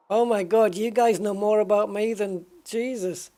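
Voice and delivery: genuinely floored by the chat; real surprise. Real, not acted. Reacting to viewers' chat.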